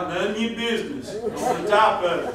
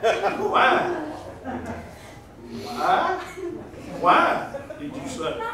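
a hint of a sulky boy, higher; whiny teen; 5 semitones higher, much slower than usual. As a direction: mimic a kid's lazy backtalk. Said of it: "He said the wrong thing back".

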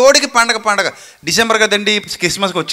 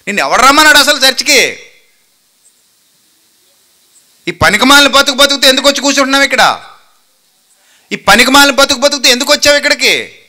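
loud, slightly higher rebuke of a latecomer; angry scolding; 5 semitones higher, 7 dB louder than usual. Shown as a demonstration. Preacher rebukes the seasonal churchgoer, loud and angry. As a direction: scold him like a stern pastor, loud. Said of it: "అని అడగాలి".

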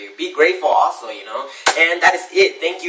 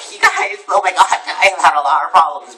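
higher, hammed-up complaint; mock whining; 4 semitones higher, 4 dB louder than usual. Showing off problems to you guys, mock whining. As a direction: play up the woe mockingly.

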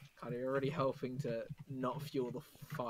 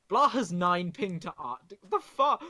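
sudden loud yelp; loud sharp voice; 4 semitones higher, 7 dB louder than usual. Real, not acted. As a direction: yell it out, startled. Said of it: "Why are you yelling?".

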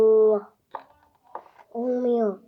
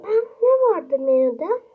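put-on child voice; 6 semitones higher, 8 dB louder than usual. High child customer.

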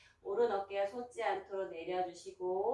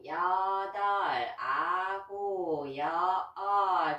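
slow counting; much slower than usual. Shown as a demonstration. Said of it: "instructor counts reps".

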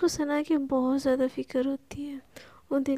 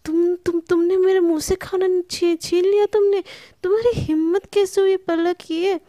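high, loud furious yell; angry shout; 5 semitones higher, 6 dB louder than usual. A narrator's reading. Sana shouting at Palak for grabbing her food, high loud yell. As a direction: yell at her for snatching the bowl. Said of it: "वो एकदम चिल्ला के पलक को बोलती है".

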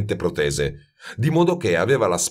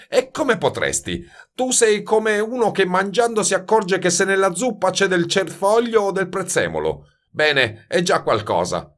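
higher, emphatic scolding master; lecturing master perfumer; 10 semitones higher than usual. A narrator's reading. Master lectures the youth on talent and experience, patronising. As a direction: lecture him from on high.

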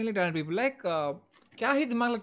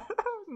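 high laugh; 12 semitones higher, 5 dB quieter than usual. Real, not acted. Overwhelmed host laughs.

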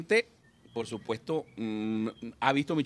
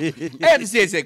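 put-on savoring drunk; 4 semitones higher, 5 dB louder, slower than usual. Drunk savoring heavenly bar.

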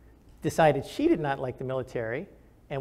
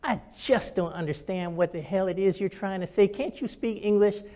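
lightly mocking syrupy voice, pitched up; syrupy accent; 5 semitones higher than usual. Officer's wife belittling his wife's English, mock syrupy accent. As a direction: imitate a syrupy, condescending put-down. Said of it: "she said in a syrupy accent".

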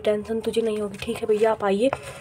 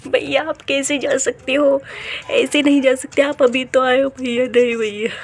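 higher, louder tearful begging; pleading; 4 semitones higher, 3 dB louder than usual. Acted. Little sister pleading with brother not to leave for the border, high and tearful. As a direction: beg him to stay, voice pushed up.

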